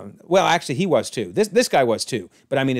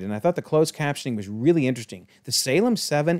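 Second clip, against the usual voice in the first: normal talking voice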